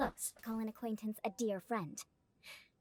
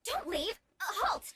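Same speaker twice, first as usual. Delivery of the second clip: much higher, urgent calls after him; calling out; 8 semitones higher than usual. Real, not acted. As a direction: call him back before he walks off. Calling after a leaving man.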